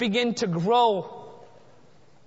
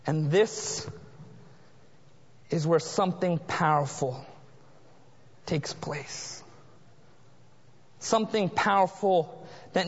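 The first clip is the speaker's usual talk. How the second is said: low hush; 9 semitones lower, 5 dB quieter than usual. Acted. Climax, low hushed.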